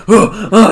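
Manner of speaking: loud puffed grunts of effort; mock push-up straining. Retelling his push-ups to a co-host, mock straining. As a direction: huff as if straining through a push-up.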